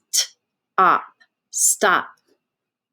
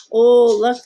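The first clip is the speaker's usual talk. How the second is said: usual speaking voice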